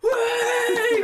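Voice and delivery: high, loud whooping cheer; long celebratory yell; crowd cheer. Acted. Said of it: "you just hear people go".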